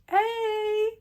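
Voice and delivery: high called-out hey; calling out. Retold or imitated. Fan calling to the goalie from the stands. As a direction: call to him across the rink. Waving to the star.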